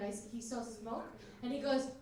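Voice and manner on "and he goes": ordinary talk, nothing marked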